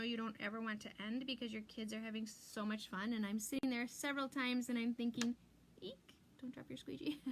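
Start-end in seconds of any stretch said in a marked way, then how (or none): 5.8-7.2 1 mock worried whisper. Mock inner panic while crafting.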